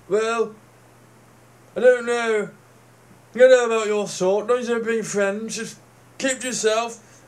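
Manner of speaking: suspicious local. Retold or imitated. Mocking a local who distrusts his sort, loud and prim. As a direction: play the wary villager looking down his nose.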